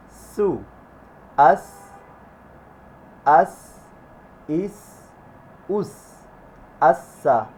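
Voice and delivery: long whistling S in "সা, সি, সু"; airy s. Shown as a demonstration. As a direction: push air through the S of "আসসা". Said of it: "বাতাস বের হবে". ("স"-তে বাতাস বের করে উচ্চারণ)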